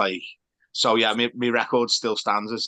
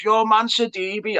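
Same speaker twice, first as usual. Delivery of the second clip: mimicked fan shout; 10 semitones higher, much slower than usual. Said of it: "and he goes".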